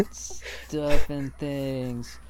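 extremely slow, stretched-out show-title read; milk the name like a radio host; slow podcaster voice. Pretend. Mocking podcasters, slowly intoning a fake show name.

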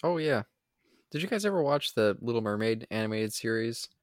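usual speaking voice

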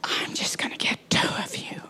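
hushed, breathy pulpit whisper. Acted. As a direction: draw them in with a whisper. Intimate whispered appeal.

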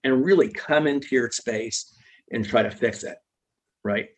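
ordinary talk

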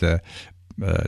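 normal talking voice

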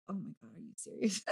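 low, quick, very soft aside; imitated dismayed husband. Wife imagining husband hearing the podcast, muttered dismay. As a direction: drop to a hushed, hurried imitation.

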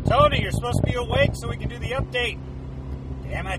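loud, frustrated yell at the phone; exasperated shout. Real, not acted. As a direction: shout in real annoyance. Calling sleeping Tony, call failed.

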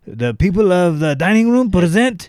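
mock French candlestick host; Lumiere voice. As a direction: present the dinner like a grand maître d'. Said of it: "sounds like Lumiere".

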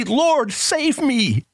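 high pleading cry of Lord save me. Acted. Preacher urges sinners to cry to the Lord, high and pleading. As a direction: call out for help, lifted high. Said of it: "turn to him again and say".

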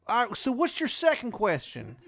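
high, loud put-on Maryland voice; pained character voice, pushed up high; Shithead Steve. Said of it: "And he's like".

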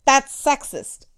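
usual speaking voice

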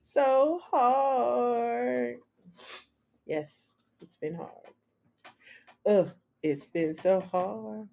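whiny fake sob; mock crying. Mock lament, cry heard.

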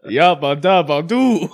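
loud, slow cartoon catchphrase; Fred Flintstone. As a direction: belt it out like the caveman. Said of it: "Fred Flintstone".

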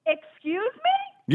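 outraged disbelief. Real, not acted. Outraged customer insulted.

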